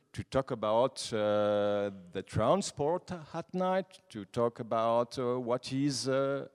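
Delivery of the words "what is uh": usual speaking voice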